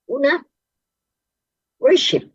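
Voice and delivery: first point called out high; emphatic point. Acted. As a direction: announce the first point with force. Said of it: "preaching point, high and loud". (madiing pagbanggit ng unang punto)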